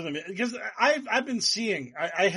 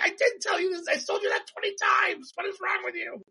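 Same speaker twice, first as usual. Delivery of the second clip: much higher squeaky exasperated yell; shrill judge; 13 semitones higher than usual. Retold or imitated. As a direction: shriek at them in cartoonish disbelief. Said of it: "I was like".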